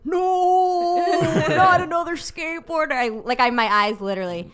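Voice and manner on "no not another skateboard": higher, louder wail of mock despair; heart's anguished cry. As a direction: cry out in hammed-up dismay, half laughing. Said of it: "my heart was like".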